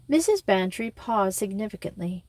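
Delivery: ordinary delivery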